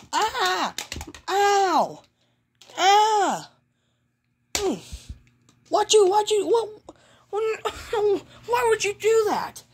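high, whimpering cartoon complaint; mock wailing. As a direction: wail the question in pretend hurt. Mickey wails after being hurt.

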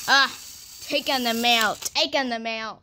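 ordinary delivery

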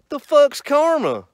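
loud, slow, confused buddy voice; loud dumb question. Retold or imitated. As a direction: yell it like you've never heard the word. Offie asking what karma is.

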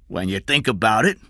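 ordinary delivery

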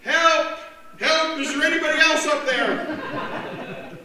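high, loud cry, laughing at the punchline; stranded man shouting past God for help; panicked shout. Acted. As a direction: yell for someone else, half laughing. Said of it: "the man cried out".

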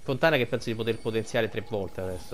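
ordinary talk, nothing marked

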